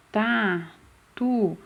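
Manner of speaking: tatu split as TA, tu; syllable by syllable. Shown as a demonstration. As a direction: stretch tatu into two separate syllables. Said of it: "qual a primeira sílaba que eu falo".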